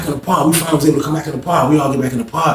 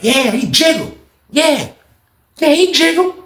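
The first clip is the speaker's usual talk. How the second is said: pitched-up mock tattling; high teasing voice; 11 semitones higher, slower than usual. [name] mocking Lawrence to everybody, high teasing voice. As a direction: tease it up high.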